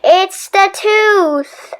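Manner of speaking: helpless excuse; slow resigned excuse. Acted. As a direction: blame the tooth slowly. Stuart excuse.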